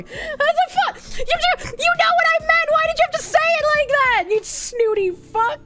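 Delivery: high-pitched laughing outrage at a snooty waiter; incredulous outrage. Real, not acted. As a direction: squeal it in mock disbelief, laughing.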